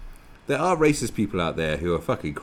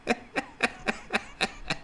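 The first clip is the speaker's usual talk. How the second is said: high, voiceless giggle; real laugh; 5 dB quieter than usual. Real, not acted. Host laughing at the dislike ratio, high giggle. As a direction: snicker high and long.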